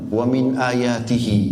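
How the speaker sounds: slow chanted Arabic verse opening; Quranic recitation. Acted. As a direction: intone it like tilawah.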